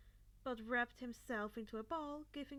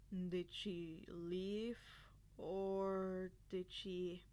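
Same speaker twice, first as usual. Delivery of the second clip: very slow, lower, careful asking; careful gentle question; 5 semitones lower, much slower than usual. A narrator's reading. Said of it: "Shota asked carefully".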